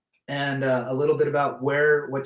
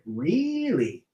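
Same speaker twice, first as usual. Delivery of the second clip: a hammed-up rubber-faced Jim Carrey really; 3 semitones higher than usual. Telling chat about the man, hammy Jim Carrey imitation. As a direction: stretch it out like a goofy Jim Carrey. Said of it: "I try to do a Jim Carrey".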